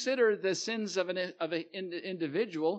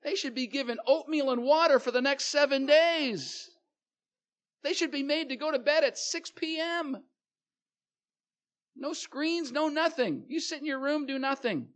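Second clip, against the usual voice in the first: much higher, loud, put-upon disbelief; outraged sibling; 9 semitones higher, 5 dB louder than usual. Retold or imitated. Sibling protests to parents over a curfew pass, high and outraged. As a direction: squeal it like the kid who played by the rules. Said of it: "you're sitting there going".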